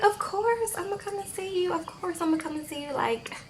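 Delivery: a lightly voiced eager girlfriend; high and soft, coy yes; imitating Lana. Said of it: "And she's like".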